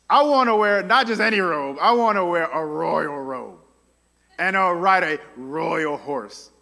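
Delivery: lightly voiced smug show-off; a touch of preening greed; mock extra Haman. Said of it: "He's so extra".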